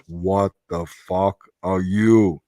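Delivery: slow, low alien growl of disbelief; weird Predator voice. Retold or imitated. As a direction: drag it out like a stunned alien hunter. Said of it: "In that weird Predator voice".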